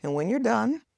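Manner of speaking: high, breezy mock husband voice; easygoing husband. Husband shrugging off her breakdown, high breezy voice. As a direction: toss it off high and light like him. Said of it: "And he was just like".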